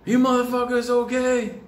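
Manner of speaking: big guy's voice. Retold or imitated. Big guy calling to kids on the ice, high put-on voice.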